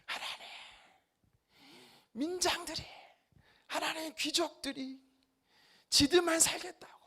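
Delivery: high, soft, pleading lament; anguished outpouring. Acted. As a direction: pour out a grievance to God in prayer. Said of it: "하나님 앞에 막 토로해야 돼요".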